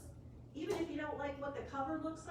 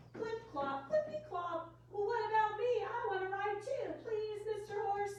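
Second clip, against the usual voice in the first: pig's voice; 8 semitones higher, 3 dB louder than usual. A narrator's reading. Reading the pig's line.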